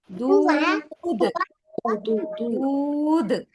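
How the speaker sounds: ദൂത് with the long ഊ stretched very slowly; drawn-out demo. Shown as a demonstration. Teacher stretching the long vowel to show how to read it. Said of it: "എന്താണിത്?".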